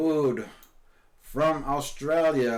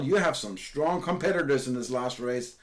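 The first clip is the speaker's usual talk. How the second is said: ordinary talk, nothing marked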